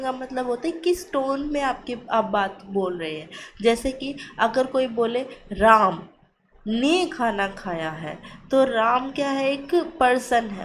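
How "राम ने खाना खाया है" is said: राम said much louder, then a pause; stress on राम. Shown as a demonstration. Teacher demonstrating word stress to students, strong stress on राम. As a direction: hit राम hard, pause, then finish evenly. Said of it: "उस पे stress दिया गया है".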